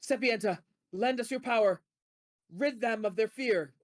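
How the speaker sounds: loud ritual plea; commanding invocation. Acted. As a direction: raise the voice, call on the goddess. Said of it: "and she goes".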